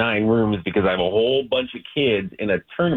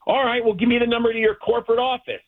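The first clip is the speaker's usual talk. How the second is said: entitled customer; 10 semitones higher than usual. Real, not acted. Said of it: "getting all angry like this".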